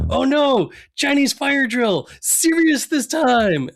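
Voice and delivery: mock panicked shopkeeper cry; shopkeeper yelling. Cohost voices store owner as fireworks explode, high mock panic. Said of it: "he goes".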